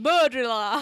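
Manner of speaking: loud, hammed-up Yorkshire accent. Shown as a demonstration. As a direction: boom it out in broad Yorkshire. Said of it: "In a Yorkshire accent".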